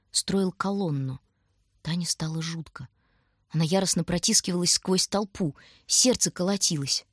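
ordinary delivery

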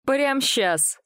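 high, sharp bark back. A narrator's reading. Young man refusing the antiquarian's demand, barked. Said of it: "рявкнул Павел".

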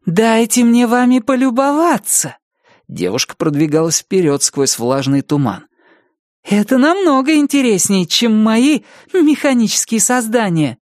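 high, thrilled and curious; delighted girl voice. A narrator's reading. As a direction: gush with excitement. Aza delighted by ghosts.

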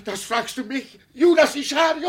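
high, loud, furious accusation; outraged shout. Acted. Deluded father shouts at the traitor, outraged. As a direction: hurl the name at him like a curse. Old man accuses his captor.

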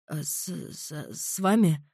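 nervous, stammering student. A narrator's reading. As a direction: stutter out of fear. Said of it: "запинаясь, пролепетал".

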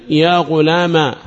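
يا غلاما ending in a long ā; ya turned alif. Shown as a demonstration. Teacher showing the third dialect, يا غلاما ending in long ā. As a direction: stretch the final ā of يا غلاما. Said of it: "وقلب الياء ألفا".

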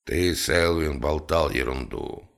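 low, displeased reproof; understated grumpy boss. A narrator's reading. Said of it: "недовольно сказал он".

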